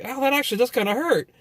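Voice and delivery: high, quick rueful surprise; surprised admission. Retold or imitated. Caught by his friend's mom, high rueful surprise. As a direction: admit it stings, half laughing. Admitting sandal hurts.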